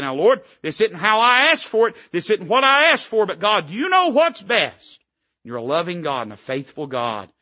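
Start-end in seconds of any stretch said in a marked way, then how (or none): 0.0-5.0 louder, higher earnest plea, slowing at the end; pleading prayer. Pretend. Preacher voices a surrendering prayer.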